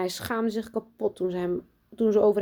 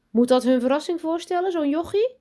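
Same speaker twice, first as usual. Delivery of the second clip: high, loud disbelieving question; incredulous scoffing; 10 semitones higher, 6 dB louder than usual. A narrator's reading. Pupils see the boy from the box, incredulous. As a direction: rise high in scornful disbelief.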